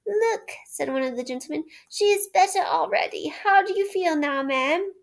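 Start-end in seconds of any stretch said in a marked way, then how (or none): 0.0-0.5 gentleman. A narrator's reading. Gentleman speaks. 1.9-4.9 gentleman. A narrator's reading. Gentleman speaks.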